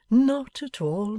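put-on Pa gently reassuring his girl; fond father, calm. Pa answers Bella.

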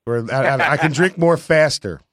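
normal talking voice

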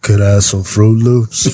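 put-on low grown man asking like a kid; deep toddler voice.

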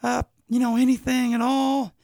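high pleading mother voice; Shmi voice. Retold or imitated. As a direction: play a poor pleading mom, high. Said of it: "Shmi is like".

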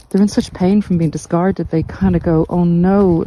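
normal talking voice

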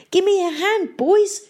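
high, desperate plea; pleading. A narrator's reading. Mayor stuck in bog to his pirates, high pleading. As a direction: beg for rescue in a high voice. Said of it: "the mayor pleaded".

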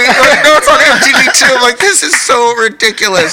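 high, loud, comic protest; outraged wail. Retold or imitated. Singer protests being exposed on music television, high outraged wail.

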